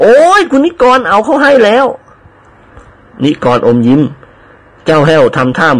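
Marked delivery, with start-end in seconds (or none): 0.0-1.9 high wailing shout of panic; cry out. Acted. Servant cries out that his ticket was swapped, high panicked wail. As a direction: yelp as if robbed. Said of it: "ร้องสุดเสียง".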